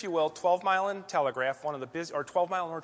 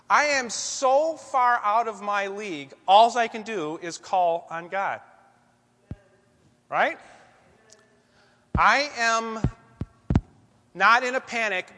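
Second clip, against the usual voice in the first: building preacher intensity; 3 semitones higher, 7 dB louder, slower than usual. Acted. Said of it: "preaching at full force".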